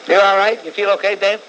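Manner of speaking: loud, insistent questioning; loudly checking in. Real, not acted. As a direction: call down the line to see who's there. Checks on a silent caller.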